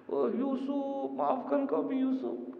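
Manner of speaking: high, pleading cry for forgiveness; brothers' wailing plea. Acted. Guilty brothers begging Yusuf for mercy, high wailing plea. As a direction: beg like guilty brothers breaking down.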